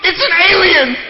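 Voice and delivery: put-on high, loud little girl shriek; shrill mock child's shout; frightened little girl. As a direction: squeal it like an alarmed child calling mom. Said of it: "there was this one girl".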